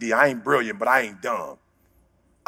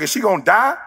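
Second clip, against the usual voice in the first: raised frightened ask; panicked question; 7 semitones higher than usual. Retold or imitated. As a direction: blurt it out in fear. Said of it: "I'm like".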